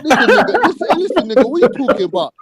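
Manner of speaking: high, urgent pitch to break in; heated interruption. Real, not acted. Shouting over the panel to get his turn, high and urgent.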